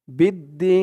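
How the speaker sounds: "বিদ্দিন" with the "দি" held three beats; Quran recitation demo. Shown as a demonstration. Teacher showing students the three-alif stretch at a stop, chanted "বিদ্দিন". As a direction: stretch the long i in "বিদ্দিন", closing on n. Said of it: "দি বলে তিন আলিফ টান দিয়ে".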